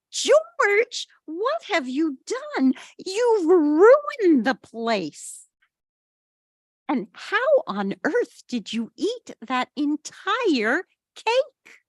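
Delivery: high, slow, dismayed scolding; an owner shocked by the mess. A narrator's reading. Harry scolds the dog.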